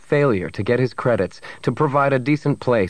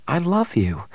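higher-pitched, tender woman's voice; loving Bria; 7 semitones higher than usual. Acted. Woman tells her rescuer she loves him, tender and higher. Bria declares love to Han.

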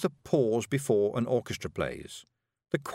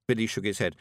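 ordinary delivery, nothing marked

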